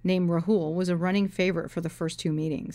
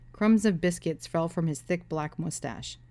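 plain reading voice

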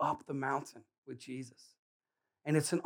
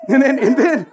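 ordinary talk

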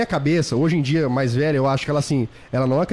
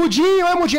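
mock taunt; 12 semitones higher, 3 dB louder than usual. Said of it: "os caras batiam na minha cabeça e falavam".

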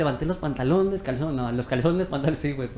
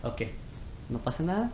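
hushed self-calming; 8 dB quieter than usual. Retold or imitated.

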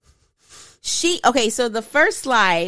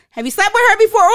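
higher, much louder shouted question; loud caps statement; 12 semitones higher, 9 dB louder, faster than usual. Shown as a demonstration. Reading the all-caps text as a loud statement, shouting. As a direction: yell it as a statement, not a question. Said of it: "That's a loud ass statement".

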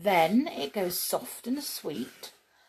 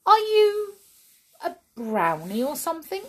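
put-on little girl voice; 9 semitones higher, 5 dB louder than usual. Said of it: "she asked".

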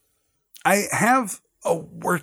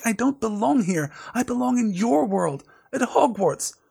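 put-on "I don't belong here" in a higher earnest boy's voice; pleading young Harry; 7 semitones higher than usual. A narrator's reading. Harry tells Dobby where he belongs, higher pleading boy voice. Reads Harry's line, later reread.

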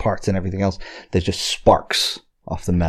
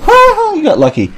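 laughing; 6 dB louder, slower than usual. Real, not acted. GM laughing at player's lucky roll.

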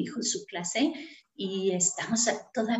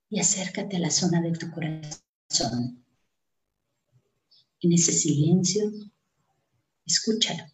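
guided meditation; 3 semitones lower than usual. Acted. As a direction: lead them gently into calm breathing.

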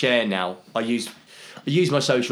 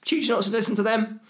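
much higher, sing-song classroom voice; teacher to pupils; 12 semitones higher than usual. Acted. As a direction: wash your hands of the pupils, high-pitched. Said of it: "how I deal with the kids at school".

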